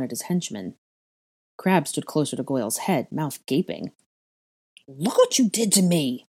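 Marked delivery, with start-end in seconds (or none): 4.9-6.3 high, outraged cry; raised-pitch wail of protest; pained cry. A narrator's reading. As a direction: cry out in accusing complaint. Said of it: "Draco cried".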